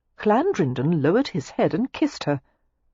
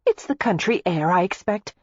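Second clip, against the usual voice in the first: lightly raised character voice; lightly voiced Daisy; 3 semitones higher than usual. A narrator's reading. Daisy explaining away his strange mood, lightly voiced.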